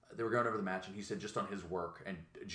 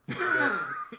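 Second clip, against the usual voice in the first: laughing; 8 dB louder than usual. Real, not acted. Bursts out laughing.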